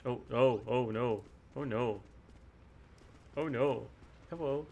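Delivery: ordinary talk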